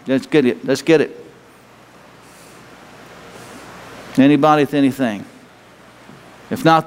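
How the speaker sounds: low, gentle prayer voice inviting them forward. Acted. Pastor praying and calling the congregation forward, gentle. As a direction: pray gently over the room.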